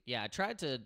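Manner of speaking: ordinary talk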